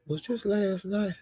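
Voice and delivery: hushed self-questioning aside; unsure aside. Real, not acted. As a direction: drop way down and check with yourself. Checking the date with herself.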